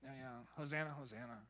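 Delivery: offhand, fading to a near-silent Hosanna; mock bored churchgoer; ordinary, bored. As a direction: shrug it off as if it means nothing. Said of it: "They just become like".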